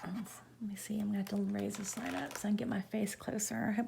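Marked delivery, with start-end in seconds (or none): 0.0-2.4 whispering. Real, not acted. Whispering while leaning in close.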